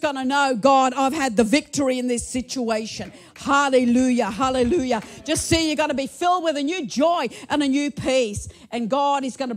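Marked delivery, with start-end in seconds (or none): none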